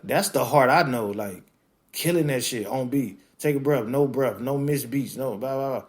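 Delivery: ordinary talk, nothing marked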